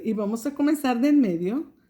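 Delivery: ordinary talk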